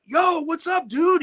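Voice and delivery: high, exuberant hail to a buddy; hearty greeting, imitated. Guitarist running into the engineer at studios, imitated exuberant greeting. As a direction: call out a big hello.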